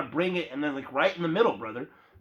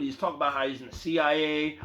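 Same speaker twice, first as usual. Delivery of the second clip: ordinary delivery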